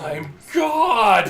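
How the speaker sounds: loud triumphant shout. Pretend. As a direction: bellow it like a god. Playing god in VR.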